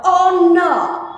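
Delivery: "Honor" with the H silent, high and loud; "Honor" as "onner", no H sound. Shown as a demonstration. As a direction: say "Honor" starting on the vowel, strongly. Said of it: "H, you're silent always".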